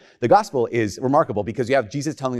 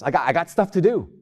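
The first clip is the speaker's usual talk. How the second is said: higher-pitched busy excuse; mock protest; 6 semitones higher than usual. Invited guest too busy for the feast, mock protest. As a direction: insist you're busy, pitched up.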